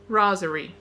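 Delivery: rosary said as RAH-zuh-ree; rosary with a flat AH start, then corrected; rosary, first try. Shown as a demonstration. Teacher's slip while reading a vocabulary list, rosary. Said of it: "ro-, sorry".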